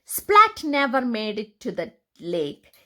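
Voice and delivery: plain reading voice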